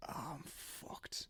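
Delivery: breathy whispered dread; whispered panic. Retold or imitated. Finds socks gone, panics.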